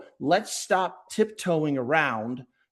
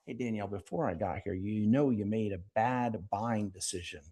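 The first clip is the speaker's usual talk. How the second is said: lightly voiced smug salesman, quick start; a mild sneer at a bad buying decision; 5 semitones lower, 5 dB quieter than usual. Shown as a demonstration. Rep to business owner, a hint of condescension. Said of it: "it comes off as really offensive".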